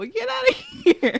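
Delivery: mock high squeal. Kid rejecting slobbery candy.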